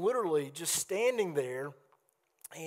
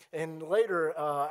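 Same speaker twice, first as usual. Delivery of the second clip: usual speaking voice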